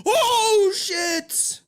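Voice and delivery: high shrieking laugh then oh shit; shocked laughter. Real, not acted. Hearing clip confirm the story.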